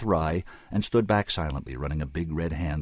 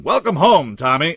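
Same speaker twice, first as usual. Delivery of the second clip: high, loud hearty roar; booming greeting, pitched well up; roaring welcome; 9 semitones higher, 11 dB louder than usual. A narrator's reading. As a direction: roar it out across the bar.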